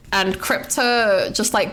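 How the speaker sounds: ordinary talk, nothing marked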